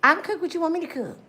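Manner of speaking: fast, high eager offer. Retold or imitated. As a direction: rush it out, overeager. Eager woman on the phone.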